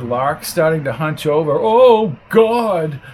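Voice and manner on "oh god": high, drawn-out dismay; acted wail of dismay; despairing groan. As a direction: groan it in mounting dread. Lark despairing, hunching over.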